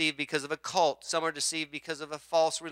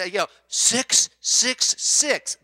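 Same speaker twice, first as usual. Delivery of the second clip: six six six drawn out slowly, louder; slow mock warning; 5 semitones higher, 5 dB louder, much slower than usual. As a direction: spell out the danger theatrically. Pastor's jokey warning.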